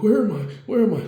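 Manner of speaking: high, loud bewildered cry; acted panicked confusion. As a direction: play someone suddenly lost and alarmed. Retold victim lost after falling.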